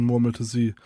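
ordinary delivery

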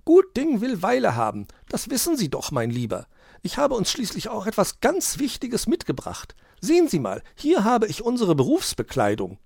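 very high, bright magpie chatter; a bossy bird showing off her bag; chirpy magpie. A narrator's reading. Frau Elster presents the Santa costumes, high and chirpy. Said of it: "erklärte die Elster".

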